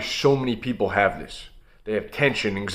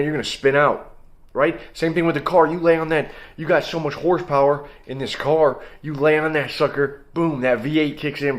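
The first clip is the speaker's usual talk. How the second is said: ordinary delivery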